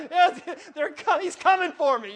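high, loud hammed-up terror; mock panic. Joking that the angel came for him, loud mock fear. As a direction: cry out as if death has come.

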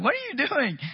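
a mock baffled what-are-you-doing; surprised. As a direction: pastor teasing a friend, surprised. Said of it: "And I'm like".

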